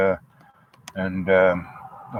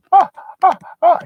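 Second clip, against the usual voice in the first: high falsetto gasps; squeaky breathless gasps at the sight; mock heart attack; 6 dB louder, faster than usual. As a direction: gasp much higher, overwhelmed. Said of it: "She nearly has a heart attack".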